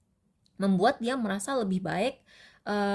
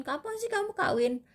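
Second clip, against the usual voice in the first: much higher, mocking prying whine; nosy asker; 12 semitones higher than usual. A relative nagging about marriage, high mocking whine. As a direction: squeak it out like a meddling auntie.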